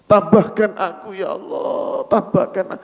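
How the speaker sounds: mild tearful prayer. Acted. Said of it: "dia hanya menangis".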